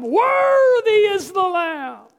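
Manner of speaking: loud, high, slow, voice catching into a cry; shouted praise with a cry. Retold or imitated. Heaven praising the Lamb, shout breaking into a cry. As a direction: shout it again as the feeling breaks through. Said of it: "they began to shout".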